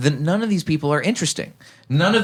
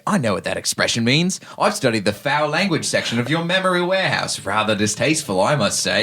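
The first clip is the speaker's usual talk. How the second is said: put-on Australian-accented movie villain. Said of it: "making him Australian".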